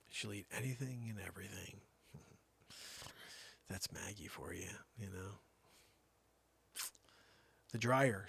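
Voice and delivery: normal talking voice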